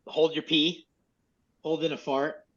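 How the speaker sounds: ordinary talk, nothing marked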